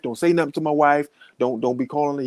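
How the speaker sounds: ordinary talk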